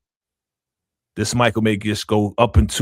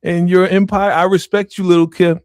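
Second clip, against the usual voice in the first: raised, louder, put-on formal speech to Kim; mock respectful address; 6 semitones higher, 5 dB louder than usual. Cohost speaking to Little Kim over here, mock formal. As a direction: talk straight to Little Kim like she's listening.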